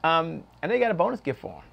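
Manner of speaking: usual speaking voice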